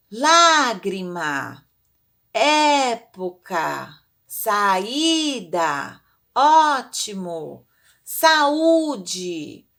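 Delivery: loud, drawn-out stressed vowels, word by word; acute-accent vowels. Shown as a demonstration. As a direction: stretch "Lágrima, época, saída, ótimo, saúde" on the accented open vowel, loud. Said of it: "deixa as vogais acentuadas e ficam mais altas".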